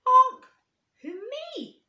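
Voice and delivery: very high, loud startled honk; can't believe they mean him; surprised. Acted. Duckling surprised.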